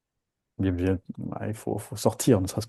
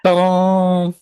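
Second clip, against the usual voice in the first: high, loud, held ta-da; playful triumphant flourish; showy fanfare; 9 semitones higher, 8 dB louder than usual. Pretend. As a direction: announce it like a magician's reveal. Playful fanfare before exercise.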